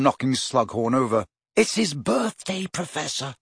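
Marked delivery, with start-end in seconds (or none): 1.6-3.3 a touch of pleading; imploring. A narrator's reading. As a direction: beg the professor gently. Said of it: "he added imploringly".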